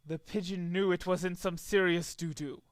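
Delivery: plain reading voice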